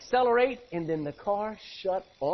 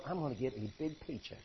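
much softer, lower and fast to himself; smug mutter; 7 semitones lower, 12 dB quieter, faster than usual. Retold or imitated. Balaam on the donkey, muttering smugly about the money. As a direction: mutter it like a greedy schemer. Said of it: "and he's going".

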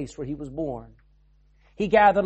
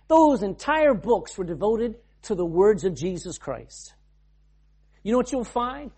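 ordinary delivery

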